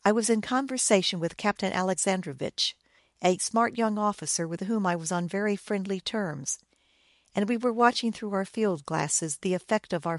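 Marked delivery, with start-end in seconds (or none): none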